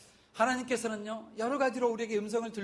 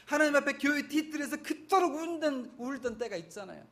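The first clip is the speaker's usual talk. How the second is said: normal talking voice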